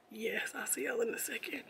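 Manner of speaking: soft whisper, nearly in tears; whispered sign-off. Real, not acted. Stepping away from camera, about to cry, whispered. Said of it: "Am I about to start crying?".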